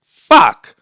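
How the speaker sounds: sudden high, loud outburst; exclaimed curse. Retold or imitated. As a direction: blurt out a loud retold curse. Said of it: "And I was like".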